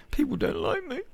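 very high, thin, self-pitying whimper; mock whiny child. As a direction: whine it like a sulking little kid.

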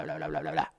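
put-on dying-down childish babble; babble fading off. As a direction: let the taunt peter out. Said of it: "se tapa los oídos y empieza a decir".

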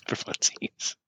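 breathy laughing whisper, slow. Real, not acted.

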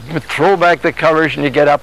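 normal talking voice

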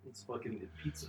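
normal talking voice

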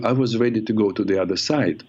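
usual speaking voice

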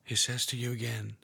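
hushed guided meditation, half whispered; guided prayer meditation. Acted. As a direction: lead them gently into prayer.

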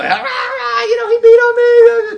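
high, wailing mock sobs of a little boy; imitating a crying child. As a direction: whine it out like a hurt kid tattling.

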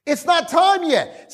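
high, stretched mock protest; hammed-up pleading on a high pitch; comic dismay; panicked demons. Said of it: "they were like".

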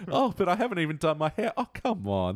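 high, laughing, coy diva; flattered diva voice. Retold or imitated. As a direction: gush like a flattered star. Continuing the flattered Nessie bit.

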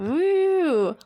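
high, loud cheer; excited whoop. Real, not acted. Cheering at podcast start.

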